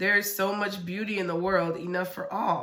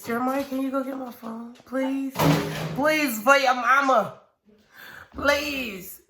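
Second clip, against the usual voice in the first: pleading; 4 semitones higher, 3 dB louder than usual. Real, not acted. Mother pleading with son for phone.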